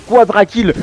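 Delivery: punchy hype announcement; excited call. Real, not acted. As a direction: call the quadra kill loud. Caster calls a quadra kill.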